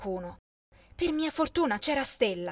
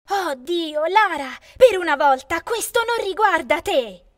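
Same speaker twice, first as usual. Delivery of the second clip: loud, high exasperated snap; fed up, shouting her sister down; furious exasperated outburst; exasperated anger; 5 semitones higher, 8 dB louder than usual. A narrator's reading. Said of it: "se ne andò infuriata".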